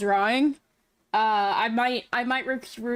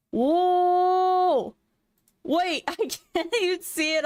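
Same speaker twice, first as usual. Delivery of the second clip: surprised; 7 semitones higher, slower than usual. Real, not acted. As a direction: react in amazement. Seeing friend's art.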